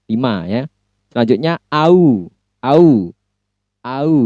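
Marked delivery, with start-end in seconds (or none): 1.7-4.1 "au" repeated, gliding from a to u; diphthong demo. Shown as a demonstration. Teacher drilling the au sound for the class. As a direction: stretch each "au" clearly from a to u. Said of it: "Gabungan antara vowels A dan juga U".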